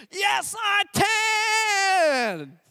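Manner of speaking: put-on very high, hugely stretched kid yell; imitated toddler scream. Toddler screams his triumph to dad, long high mock scream. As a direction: scream it like a toddler, holding the last word. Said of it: "he screams".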